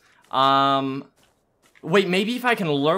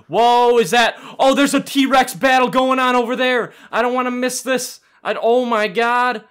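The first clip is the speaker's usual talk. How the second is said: loud, higher-pitched burst of excitement; excited alarm; 7 semitones higher, 7 dB louder than usual. Real, not acted. Player spotting a T-Rex battle, loudly excited. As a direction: exclaim in real excited surprise.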